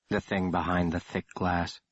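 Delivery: plain reading voice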